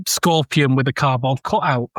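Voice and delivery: usual speaking voice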